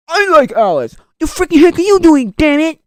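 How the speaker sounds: loud, high-pitched mock outrage; acted outburst. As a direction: act out an angry movie confrontation. Acting out movie quarrel.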